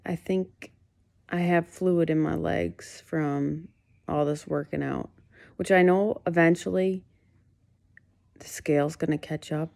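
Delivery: usual speaking voice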